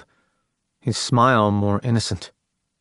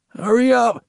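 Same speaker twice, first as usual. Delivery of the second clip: much higher, croaking urgent man; 12 semitones higher than usual. A narrator's reading. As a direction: croak it out in a hurry. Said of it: "he croaked".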